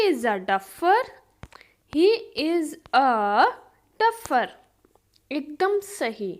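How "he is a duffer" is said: drawn-out words, pause before the last; slow teaching repeat. Shown as a demonstration. Teacher repeats the duffer example for learners, slow and split. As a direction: stretch each word, then set "duffer" apart.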